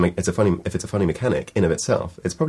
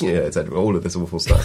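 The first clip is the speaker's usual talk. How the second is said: ordinary talk, nothing marked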